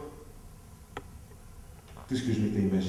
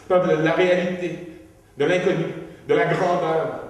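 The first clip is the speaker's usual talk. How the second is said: higher and louder, pressing on; mounting panic; 7 semitones higher, 6 dB louder than usual. Acted. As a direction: fears spilling out, building. Fear list building to outburst.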